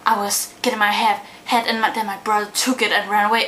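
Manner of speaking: ordinary talk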